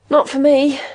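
said through a swallowed yawn; half yawning; stifling a yawn. Acted. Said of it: "swallowing a yawn".

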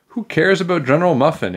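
high, exasperated outburst; indignant yell. A narrator's reading. Andrea yelling at a grown-up, indignant yell. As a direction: throw the question back, pitched high. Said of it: "Andrea yelled".

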